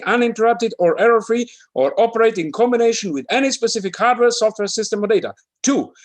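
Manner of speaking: high, loud, hammed-up disclaimer recital. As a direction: read the warranties in a high, shrill-sounding character pitch. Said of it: "Who feels expressive today?".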